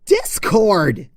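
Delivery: a loud, high furious shout at the sky; angry shout. Acted. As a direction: shout the name up to the heavens in rage. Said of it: "she shouted to the heavens".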